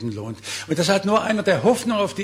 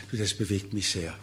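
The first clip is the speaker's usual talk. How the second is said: softer, a touch of emotion; quietly moved; 6 semitones lower, 6 dB quieter than usual. Retold or imitated. Young person sharing their need, quietly moved. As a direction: confide it softly.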